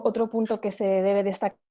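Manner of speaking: ordinary talk, nothing marked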